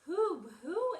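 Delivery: high, loud owl hoots; hooting owl. A narrator's reading. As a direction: hoot it like a storybook owl. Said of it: "asked the owl".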